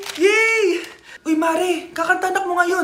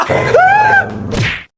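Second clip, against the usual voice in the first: much higher, louder triumphant laugh; gleeful loud cackle; 7 dB louder than usual. Acted. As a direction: cackle as she runs off. Dog chases girl off. (matinis na malakas na tawa)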